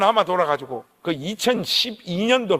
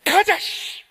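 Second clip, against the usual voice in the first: hearty mock exclamation; 10 semitones higher, much slower than usual. Pastor gives in, agrees to eat.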